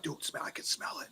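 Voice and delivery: urgent hushed whisper to a partner. Retold or imitated. Whispering to Wojo in the dark woods that the smell is back, urgent whisper. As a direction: whisper it so nothing out there hears.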